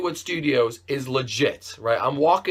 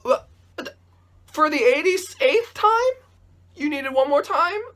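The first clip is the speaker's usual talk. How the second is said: much higher, slowed, laughing disbelief; incredulous; 13 semitones higher than usual. Real, not acted. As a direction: squeak it out in laughing outrage. Outraged disbelief at boss.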